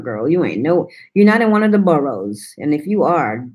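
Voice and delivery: normal talking voice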